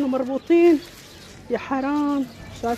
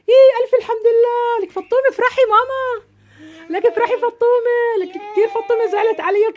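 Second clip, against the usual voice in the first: higher and louder, bursting with happiness; joyful relief; 7 semitones higher, 5 dB louder than usual. Acted. Mother hearing the kids are safe, overjoyed and loud. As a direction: cry out thank God, overjoyed. Joy at news children found.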